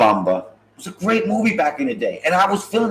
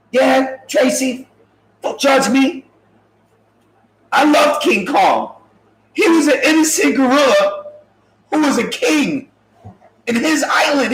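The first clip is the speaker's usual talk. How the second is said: impassioned defense; 6 semitones higher than usual. Real, not acted. Telling Tracy, defending King Kong, high and impassioned.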